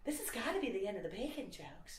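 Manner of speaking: imitated onlooker's high commentary; meta-discourse voice. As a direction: grumble high, under the act. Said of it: "meta-discourse voice".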